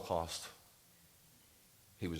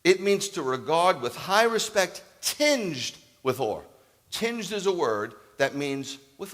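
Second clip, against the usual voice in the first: forceful preaching; 9 semitones higher, 11 dB louder than usual. Acted. Preacher to congregation on honouring parents, forceful sermon. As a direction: preach it from the pulpit with full force.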